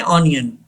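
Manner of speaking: "ऑनियन" starting with an open aw; mispronunciation. Retold or imitated. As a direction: say "ऑनियन" with a full O up front. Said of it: "गलत pronounce करते हैं". ("ऑनियन", गलत उच्चारण में शुरू में "ऑ")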